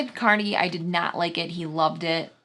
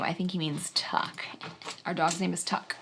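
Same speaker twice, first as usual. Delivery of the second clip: whispering; 8 dB quieter than usual. Real, not acted. Quiet aside correcting name.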